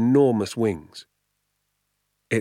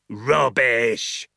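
a louder, higher retort; mild yell; 3 semitones higher, 3 dB louder than usual. A narrator's reading. Hazel yells at the father, scornful. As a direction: spit out the word. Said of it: "yelled Mister Hazel".

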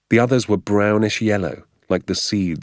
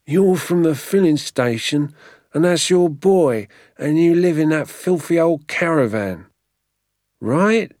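high and louder, scornful; contemptuous keeper; 10 semitones higher, 4 dB louder than usual. Acted. Keeper insulting father and son, loud scornful voice. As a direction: spit out filthy old caravan with disdain.

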